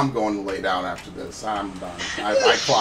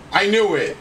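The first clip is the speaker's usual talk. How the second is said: triumphant; 7 semitones higher, 4 dB louder than usual. Real, not acted. Triumphant gaming outburst.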